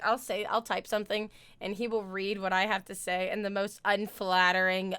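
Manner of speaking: usual speaking voice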